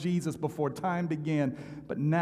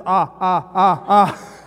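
mock tongues; 3 semitones higher, 11 dB louder, much slower than usual. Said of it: "All I had was".

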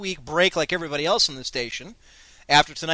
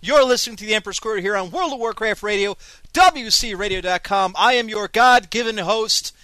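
ringmaster; 4 semitones higher, 3 dB louder than usual. Acted. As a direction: call it out like a circus ringmaster. Show host's big opening announcement.

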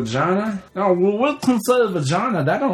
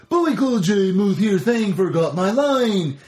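goofy dopey moose voice, lightly done; a light take on the cartoon moose; old Bullwinkle impression; 4 semitones higher than usual. Explaining cartoon voices, a light Bullwinkle imitation. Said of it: "There's the old original Bullwinkle".

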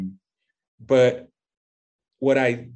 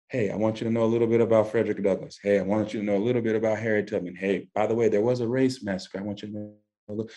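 casual parental aside; 2 semitones lower, 4 dB quieter, much faster than usual. Retold or imitated. Father slipping history to his young children, in quick, understated asides. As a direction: toss it out fast and lightly, like passing mentions.

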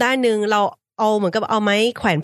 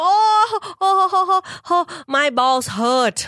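mock cries of pain; 7 semitones higher, much slower than usual. Imitating Pi Mee on the harness, slow mock cries of pain. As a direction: stretch each "oh" slowly, imitating the pain. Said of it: "แล้วตะโกนลั่น".

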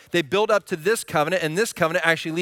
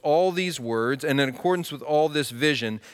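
scripture reading; 4 semitones lower than usual. Acted. Reading scripture before sermon.

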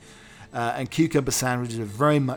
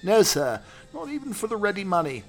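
higher deferential butler; the butler telling his master there were none; butler Lane; 9 semitones higher than usual. Acted. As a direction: report it stiffly, like a servant. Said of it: "There were no sandwiches".

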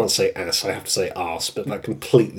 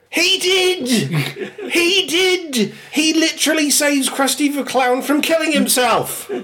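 very high laughing protest; real incredulous laughing; laughing outrage. Real, not acted. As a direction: squeal it through laughter. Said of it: "laughing outrage".

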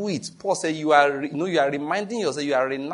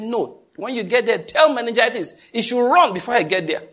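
defiant retort; 8 semitones higher, 6 dB louder than usual. Retold or imitated.

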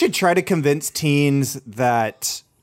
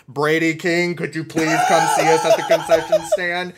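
theater announcer; slower than usual. Retold or imitated. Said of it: "be like".

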